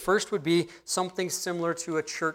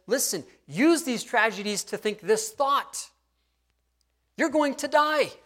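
high, loud preacher's warning; urgent warning; 9 semitones higher, 4 dB louder than usual. Retold or imitated. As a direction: build slowly to a high, forceful declaration.

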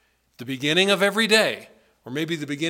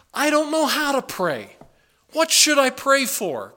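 confused believer; 8 semitones higher, 4 dB louder than usual. Pretend. Voicing a confused believer's question.

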